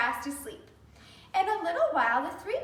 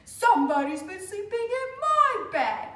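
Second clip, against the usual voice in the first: louder big bear voice, a little higher; growly father bear; 6 semitones higher, 5 dB louder than usual. Acted. Father bear seeing his untidy bed, big and loud. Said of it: "great big growly voice".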